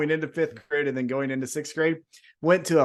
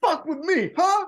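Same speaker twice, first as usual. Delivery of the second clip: mock-tough kid boasting after a lucky punch; cocky kid taunt; 13 semitones higher than usual. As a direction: crow at the room like a tough little kid. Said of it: "I was like".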